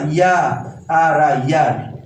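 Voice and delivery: या, आर, आ, यार broken into pieces and then joined; teacher splitting यार into its sounds; sounding out the word. Shown as a demonstration. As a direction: spell out या, आर, आ, then say यार, dropping low. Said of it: "इसका pronunciation ई नहीं होता".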